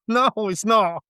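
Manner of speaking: high-pitched mock objection; playful protest. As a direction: insist it's not a compliment, voice way up. Protesting the compliment.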